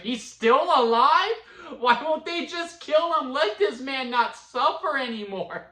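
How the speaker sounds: high, loud, slow mock outrage; exasperated at the show; shocked disbelief. His own shocked reaction quoted.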